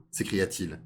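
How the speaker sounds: ordinary delivery, nothing marked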